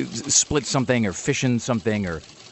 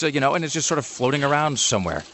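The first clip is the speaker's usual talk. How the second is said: ordinary talk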